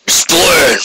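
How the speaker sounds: lightly voiced stern demand; a hint of a scornful old officer; stern commander. A narrator's reading.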